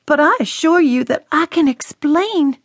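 the daughter pleading with her angry father. A narrator's reading. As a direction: beg him to let her explain. Daughter voiced pleading explanation.

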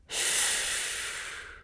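soft breathy shush repeated back; echoed whisper. A narrator's reading. Marie echoing the voice, whispered shush. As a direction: whisper it back, calming yourself. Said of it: "she echoed".

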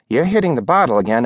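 fast, rising repeat of the rebuke; hurried accusation. Acted. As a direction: rattle off the charge again. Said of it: "landlady repeats accusation".

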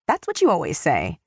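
pert, cheeky cat voice. A narrator's reading. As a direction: toss it back saucily. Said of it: "replied the little cat pertly".

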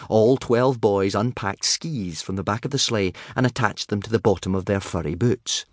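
usual speaking voice

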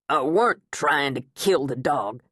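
lightly voiced wounded protest; outraged teen. A narrator's reading.

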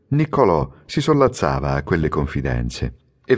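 ordinary delivery, nothing marked

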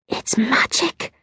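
slow, excited whisper; hushed whisper of wonder. A narrator's reading. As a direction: whisper it slowly, soft and high. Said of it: "Kirsty whispered".

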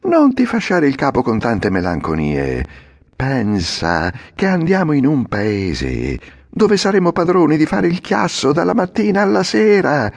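put-on boy's voice rising to excitement; Lucignolo, persuasive. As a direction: sell him the dream of endless play. (Lucignolo persuasivo, sempre più acceso)

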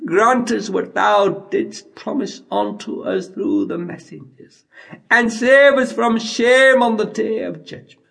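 ordinary delivery

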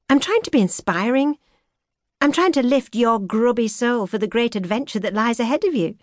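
playful grand earnestness; mock-inspiring female voice. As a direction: lift the soul, a little tongue in cheek. She earnestly defends herself, high.

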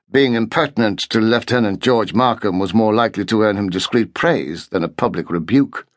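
ordinary delivery, nothing marked

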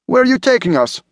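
high, urgent, frightened question; acted high-pitched alarm; alarmed demand. A narrator's reading. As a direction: demand answers in rising panic. Passengers abducted in a speeding cab.

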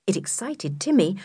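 ordinary delivery

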